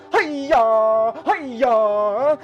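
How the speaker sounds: cartoonish mock katana yells; mock sword cries. [name] mimics Jagoro swinging a katana, mock yells. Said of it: "swinging around".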